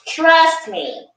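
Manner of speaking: ordinary talk, nothing marked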